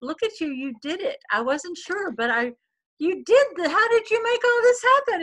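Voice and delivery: high, excited proud husband; husband's proud voice. Retold or imitated. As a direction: gush with amazed pride. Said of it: "he goes".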